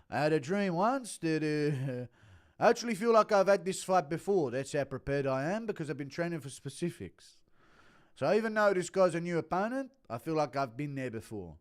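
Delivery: impression of a fighter at a media scrum; CT Volk. Fighter telling the media about his dream, impersonated voice. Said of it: "Impression of C T Volk telling the media".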